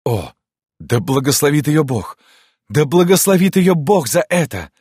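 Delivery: high, loud rapturous blessing, building; loud exclamation. Acted. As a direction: exclaim it with overflowing gratitude. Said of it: "громко воскликнул". (громкое восторженное восклицание)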